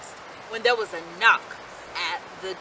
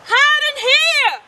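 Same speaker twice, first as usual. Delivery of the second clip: much higher, loud and slow; urgent cry; 14 semitones higher, 10 dB louder, slower than usual. A narrator's reading. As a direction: call the brothers to safety. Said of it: "cried the third little pig".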